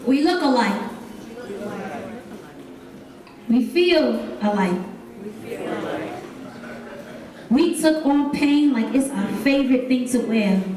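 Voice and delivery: poem delivered with building intensity; spoken word poem. Acted. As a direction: perform it like a slam poem to a crowd.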